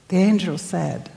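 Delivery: ordinary delivery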